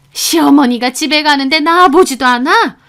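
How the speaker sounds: loud, high-pitched telling-off; angry mother-in-law. A narrator's reading. Mother-in-law scolding over the missed send-off, angry and loud. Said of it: "그때 그 목소리로 화를 내시는 겁니다".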